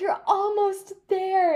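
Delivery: high, drawn-out cheer; high-pitched stretched encouragement; encouraging spectator. Retold or imitated. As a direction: imitate a well-meaning spectator, singing out slowly.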